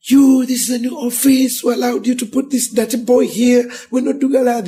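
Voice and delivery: high, loud scolding pastor; angry pastor. Retold or imitated. Pastor shouting at a kneeling boy, high and angry. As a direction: scold the dirty boy out of your office.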